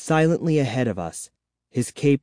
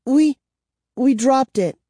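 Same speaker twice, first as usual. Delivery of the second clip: high, frightened stammer; 13 semitones higher, 4 dB louder than usual. A narrator's reading. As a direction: stumble over the words in fear. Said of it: "Kara stammered".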